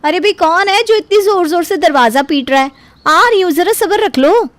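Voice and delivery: calling out. Acted. Daughter-in-law calling out to the person pounding on the door. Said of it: "आवाज़ देते हुए कहती है".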